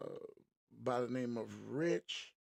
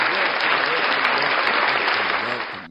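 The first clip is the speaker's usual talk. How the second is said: loud, drawn-out host greeting; booming welcome; 15 dB louder than usual. Real, not acted. Podcast host opening the show with a big booming welcome. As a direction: boom it out to open the show.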